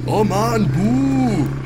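high, far-off booing call; distant drunken boo. Acted. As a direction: boo them from across the camp. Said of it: "schreit Sören von weiter weg".

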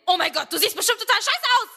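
high, hammed-up panic; mock-panicked inner monologue before a date. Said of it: "das klingt ungefähr so".

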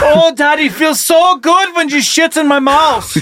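high, slow, heavy mock German accent; hammed-up German soldier, drawing out each word. Talking toilet making demands of its user, mock German soldier. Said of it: "It's the voice of a German sh-, soldier".

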